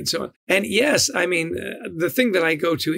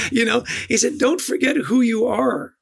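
laughing retelling; 5 semitones higher than usual. Retold or imitated. Retold advice, laughing.

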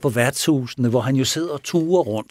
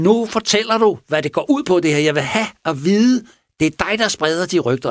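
higher, louder, demanding the truth; mock angry demand; 6 semitones higher, 4 dB louder than usual. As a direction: press him hard, voice raised high. Furious man demands a confession.

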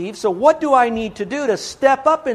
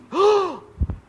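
sharp gasp; 12 semitones higher, 4 dB louder than usual. Acted. Preacher realizes he could be deceived.

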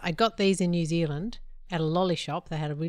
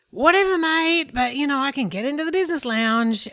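dismissive arrogance; 6 semitones higher, 5 dB louder than usual. Retold or imitated. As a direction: wave him off like you know better. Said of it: "And I went".

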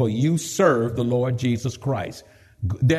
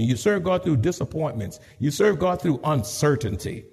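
ordinary delivery

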